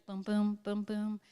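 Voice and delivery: softly pulsed boom, boom; hushed steady heartbeat beats. Shown as a demonstration. Showing the audience the fireflies' rhythm, soft and steady. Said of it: "it'll be like heartbeat".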